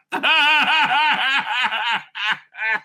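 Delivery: long cackling laugh; real laughter. Real, not acted. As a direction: crack up and let it roll. Laughing at prospect of being clipped.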